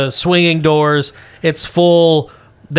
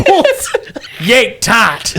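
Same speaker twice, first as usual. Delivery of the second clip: laughing; 7 semitones higher than usual. Real, not acted. Laughing through crude metaphor.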